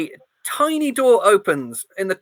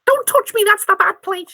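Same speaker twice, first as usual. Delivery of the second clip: squeaky mouse; 10 semitones higher than usual. Acted. Mouse protesting being petted, high and squeaky. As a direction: squeak it out in alarm.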